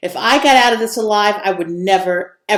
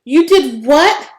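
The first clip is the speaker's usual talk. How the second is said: higher, louder, slower gasp of disbelief; a stunned, pitched-up "you did what"; shocked disbelief; 7 semitones higher, 6 dB louder, slower than usual. A narrator's reading. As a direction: drag it out, stunned. Vera peed in her suit.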